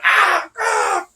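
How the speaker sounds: high yelps of pain, hammed-up; imitating a man being beaten, yelping high; mock pained cries. Retelling the attack on Gargano, mock pained yelps. Acting out wrestler getting hurt.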